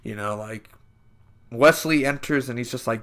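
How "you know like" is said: normal talking voice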